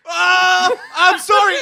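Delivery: high, loud, crying apology; panicked shout. Retold or imitated. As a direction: wail the sorry out. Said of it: "You can't go".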